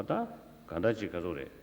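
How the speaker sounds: ordinary delivery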